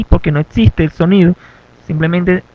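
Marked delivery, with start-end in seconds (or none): none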